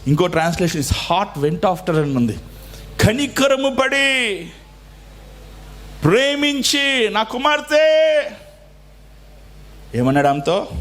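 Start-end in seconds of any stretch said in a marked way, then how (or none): 3.0-8.2 high, slow, ringing proclamation; preaching at full force. Acted. Pastor proclaiming to the congregation, high and slow. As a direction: lift it up high and slow for the church.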